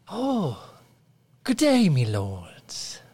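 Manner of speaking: high, eager peasant greeting; peasant villager. Acted. GM greets players as humble peasant, high and eager. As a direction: welcome the lords like a fawning villager.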